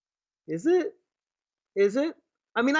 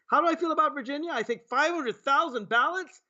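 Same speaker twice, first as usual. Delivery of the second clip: higher, fast mocking echo; a delegate mocking the president's question; mock incredulous; 6 semitones higher than usual. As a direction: throw the question back in disbelief. Mock delegate answering Trump.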